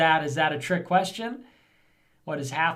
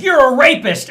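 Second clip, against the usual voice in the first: high, loud mock yell; mock accusation; 9 semitones higher, 12 dB louder than usual. Streamer acting Hillary at a debate, loud and high. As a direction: fling the accusation like a debate jab. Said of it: "Hillary Clinton was like".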